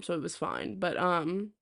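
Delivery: usual speaking voice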